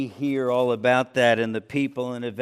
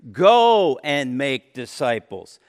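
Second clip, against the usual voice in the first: "Go" punched, phrase said slowly and louder; stress on go; 8 dB louder, slower than usual. Shown as a demonstration. Preacher showing the verse's command, slow and emphatic. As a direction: hit "Go" like a command. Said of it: "That is an imperative".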